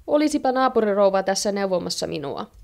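ordinary delivery, nothing marked

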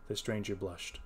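ordinary delivery

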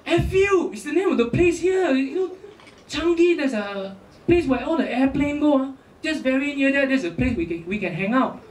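imitated Ah Beng's Singlish. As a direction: explain earnestly in Singaporean accent. Ah Beng explains the place.

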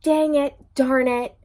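loud, high, annoyed outburst; real frustration. Real, not acted. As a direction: kick yourself out loud for missing it. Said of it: "Oh, I should have said it".

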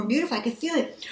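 normal talking voice

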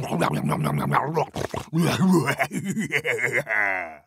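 a greedy gobbling cackle; evil troll cackle. Acted. Troll cackling over its catch, greedy gobbling cackle.

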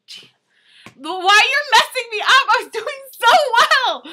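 high, loud wail of complaint; exasperated protest. Real, not acted. Vlogger to friend distracting her reading, exasperated wail. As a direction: whine loudly, stretching the last words.

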